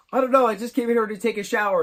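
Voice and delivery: high, loud, rushed denial; play-acted brother feigning innocence; mock innocent excuse. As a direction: blurt the alibi fast and high. Acts out Roderick's innocent excuse.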